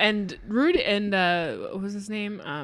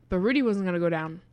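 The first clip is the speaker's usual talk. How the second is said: ordinary talk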